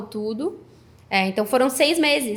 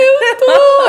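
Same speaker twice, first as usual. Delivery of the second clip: high, loud, tearful insistence; retold tearful cry; 11 dB louder than usual. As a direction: cry out that you really are. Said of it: "Aí eu comecei a chorar". (grito choroso e agudo, reencenado)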